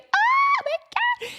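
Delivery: very high, slow squeaky gasp; gasping amazement. Retold or imitated. Friend imitating the gasping reaction to a proposal, high-pitched shock. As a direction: draw it out in hammed-up, breathless shock. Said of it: "like, gasp girl face".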